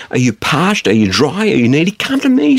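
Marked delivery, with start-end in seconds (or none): 1.9-2.6 high, ringing invitation; Jesus calling out. Acted. Preacher voicing Jesus inviting the thirsty, high and ringing. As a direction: call out to the thirsty. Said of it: "says Jesus".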